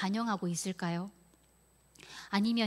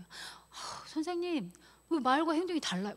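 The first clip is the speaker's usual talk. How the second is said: higher-pitched voice of a complaining pupil; pupil's retold protest, pitched up; protesting child; 7 semitones higher than usual. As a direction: lightly imitate a child challenging the teacher. Acts out children protesting to teacher.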